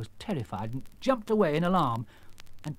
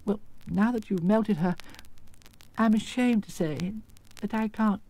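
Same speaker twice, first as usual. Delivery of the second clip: lightly voiced, higher little-man storyteller; meek little man; 7 semitones higher than usual. Exposed wizard telling visitors his story, meek. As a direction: recount it in a mild, raised voice.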